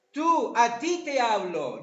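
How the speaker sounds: "Tú" punched, "a ti te hablo" drawn out; stress on tú. Shown as a demonstration. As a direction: point the word "Tú" straight at the listener. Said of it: "y enfatiza utilizando esa palabra".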